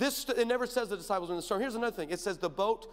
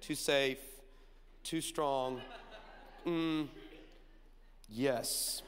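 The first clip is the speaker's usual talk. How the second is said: low, amused Jesus voice; low mock Jesus judging boats; 6 semitones lower, 7 dB quieter, much slower than usual.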